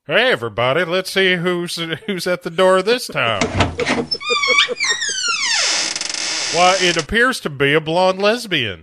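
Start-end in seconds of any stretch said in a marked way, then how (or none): 0.1-3.4 mock host. Game show host parody. 3.5-6.5 squealing laugh. Real, not acted.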